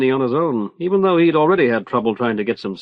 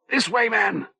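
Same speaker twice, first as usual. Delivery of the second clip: panting; 9 semitones higher, 3 dB quieter than usual. A narrator's reading. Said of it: "he panted".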